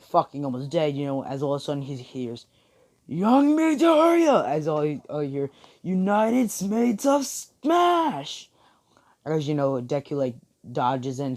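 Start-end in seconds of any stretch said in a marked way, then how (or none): 3.1-4.5 very high, loud, drawn-out shout; acted anime hero's alarmed yell; heroic call. All Might calls out to Midoriya. 5.8-8.5 high, slow, heroic battle yell; attack cry. Acted. Hero's attack cry acted out.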